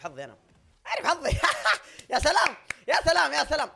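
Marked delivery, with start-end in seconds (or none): 0.9-1.3 high, loud cry breaking into laughter; loud exclamation. Real, not acted. Player exclaiming over own luck in the game, loud exclamation. 1.4-2.1 loud burst of laughter. Real, not acted. Player reacting to own luck, loud laugh. 2.1-3.7 high, loud repeated exclamation; loud exclaiming. Real, not acted. Player reacting to a play in the match, loud exclaiming.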